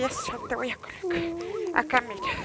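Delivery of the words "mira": ordinary talk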